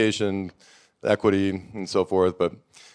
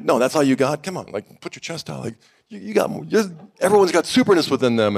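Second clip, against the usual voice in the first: excited coaxing, higher; pep-talk coaching; 8 semitones higher than usual. Retold or imitated. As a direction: hype them up like a coach. Pep-talking strangers at Sundance.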